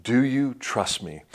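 slow callback line. Shown as a demonstration. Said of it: "it's the callback line".

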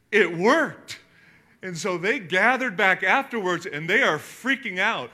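loud, high-pitched excitement; bursting with excitement; excited. Real, not acted. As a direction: shout the punchline with glee. Excited storytelling climax.

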